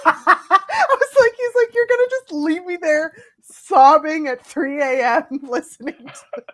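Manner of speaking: slow, high giggly retelling; words squeezed through laughter; laughing through it. Real, not acted.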